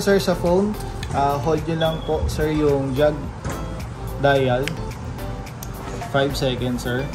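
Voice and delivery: ordinary talk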